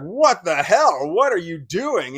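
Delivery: loud, high, slow outburst; acted-out indignant challenge; shocked confrontation. Husband confronting charging wife.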